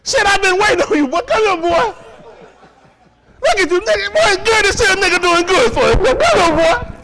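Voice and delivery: high, loud, gloating voice with a laugh. Acted. Playing a slave owner who caught the student, gloating and loud. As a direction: relish catching your prey. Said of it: "Your mama asking, boy".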